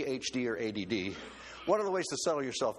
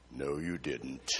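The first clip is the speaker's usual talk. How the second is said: low, softer voice of God; God's stern reply; 13 semitones lower, 4 dB quieter, much slower than usual. Retold or imitated. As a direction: answer low and firm as the Lord. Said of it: "And the Lord said".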